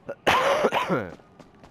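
loud harsh cough; real cough. Real, not acted. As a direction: hack out a rough cough. Cough after "bit of a cough.

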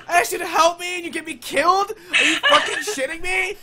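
high, loud burst of disbelief; indignant outburst. Real, not acted. Player asked for help and got killed, indignant outburst. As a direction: scold the friend, worked up and incredulous. Scolding friend after being killed.

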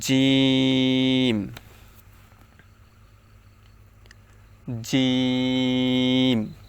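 জিম said firmly, very drawn out; hard jim. Shown as a demonstration. Teacher modelling a hard letter, very slow. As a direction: hold জিম long and hard. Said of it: "উচ্চারণটা হচ্ছে শক্ত".

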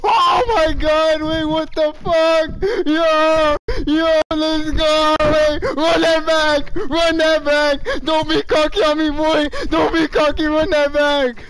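loud, high, wild celebration; real high-pitched hype screaming; ecstatic shouting. Real, not acted. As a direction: yell it like you just won it all. Celebrating a big play in game.

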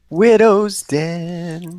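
very slow, drawn-out map name; slow, eerie show-host drawl; spooky map announcement. Acted. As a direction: stretch it out like a haunted-house host.

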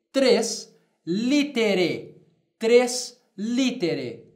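very slow tres litere, syllables stretched; slow Latin model. Shown as a demonstration. Teacher dictating Latin numeral phrase to class. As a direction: dictate it slowly twice. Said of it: "eu direi".

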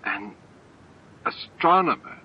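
stunned, breathless question; gasped weakly. A narrator's reading. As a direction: gasp it out in disbelief. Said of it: "he gasped weakly".

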